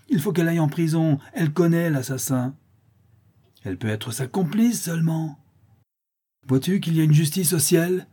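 high, vehement accusation; crowd outcry. A narrator's reading. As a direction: clamour for her punishment. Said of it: "s'écriaient les laboureurs". (clameur accusatrice)

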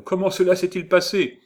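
high, loud, urgent question; acted burst of curiosity; eager questioning. A narrator's reading. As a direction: ask breathlessly keen for news. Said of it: "lui dit-il en courant au-devant d'elle".

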